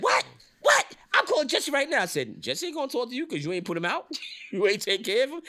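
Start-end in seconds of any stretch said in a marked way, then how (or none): 0.0-2.0 high, loud, disbelieving shouts; hammed-up indignant phone holler; mock outraged yell. Acted outraged caller on the phone. 2.4-5.4 high-pitched, needling retort with a laugh; playful retold taunt; teasing. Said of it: "I'm teasing him the whole thing".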